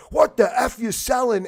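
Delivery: high, loud snapped question; angry shout. Retold or imitated. Shouting at salesmen before slamming the door. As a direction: bark it at the pushy salesmen. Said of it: "And then I said".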